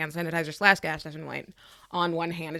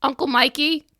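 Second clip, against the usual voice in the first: higher, louder pointed grievance; loud confrontation; 4 semitones higher, 6 dB louder than usual. Acted. Character loudly airing a grudge to Uncle Mikey's ghost. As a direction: air the grudge, pushing hard.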